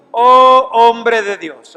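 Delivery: slow and loud, stretch out oh hombre de Dios; drawn-out exclamation. Shown as a demonstration. Preacher savouring the phrase to his congregation, slow and loud. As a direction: savour the phrase slowly and loudly. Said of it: "me encanta esa frase".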